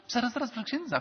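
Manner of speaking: high-pitched mock interjection; mock interrupting objection. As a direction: butt in as a nagging objector. Imitated objector interrupts the priest.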